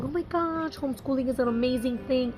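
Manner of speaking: high, gushing mock video mom. Homeschool mom mimicking moms in videos, gushing. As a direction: imitate a mom raving in a video. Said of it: "all those videos of moms saying".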